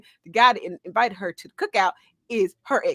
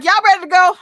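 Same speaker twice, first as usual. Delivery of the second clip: put-on angry girl; 7 semitones higher, 10 dB louder, much faster than usual. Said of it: "Angry girl's like".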